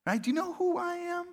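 mock offended big shot; indignant do you know who I am. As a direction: poke a finger in their chest as you ask. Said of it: "like a finger in a chest".

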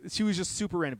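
ordinary talk

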